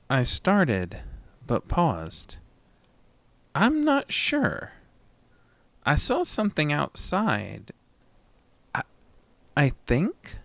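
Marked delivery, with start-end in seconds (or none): 3.6-10.4 shaken and unsure. A narrator's reading. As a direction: stumble over it, still scared. Shaken narrator after seeing creature.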